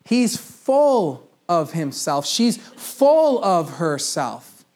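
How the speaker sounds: very slow, each word stretched; drawn-out saying. Shown as a demonstration. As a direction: stretch the phrase out mockingly. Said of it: "what do we say".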